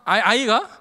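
ordinary talk

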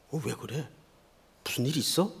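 softly voiced gossiping congregation; imitated murmuring. Church members wondering about the pastor's bodyguard, hushed murmur. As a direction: drop your voice as if asking around discreetly. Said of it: "그 교인들이 수군거렸죠".

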